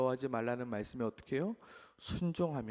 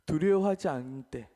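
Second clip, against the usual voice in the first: usual speaking voice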